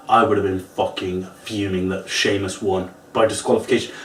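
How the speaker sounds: normal talking voice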